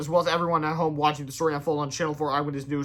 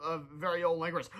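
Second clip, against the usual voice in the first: usual speaking voice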